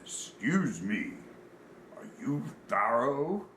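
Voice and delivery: slow, deliberate big-man voice; drawn-out question from a giant stranger; very large man. Retold or imitated. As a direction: imitate a huge man asking slowly.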